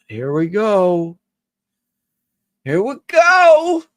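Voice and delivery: slow, drawn-out here we go rising way up high and loud; taunting mock chant, stretched; sing-song mock. Teasing friend about passive aggressive texts, slow sing-song mock.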